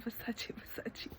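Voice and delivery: "Versace" repeated, fading to a whisper; fading mock Versace. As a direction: let each "Versace" drop away to nothing. Mocking repeated brand name.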